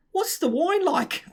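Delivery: high, slow mock South African; South African accent. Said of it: "we had to speak like that".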